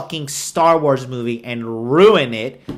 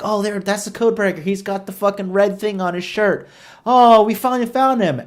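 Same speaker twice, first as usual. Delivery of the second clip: higher and fast, hammed-up cops; mock excited cops; 7 semitones higher, 4 dB louder than usual. Mocking the police who find the code breaker, higher hammed-up chirp. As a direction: chirp it like cartoon police spotting him. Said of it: "They're like".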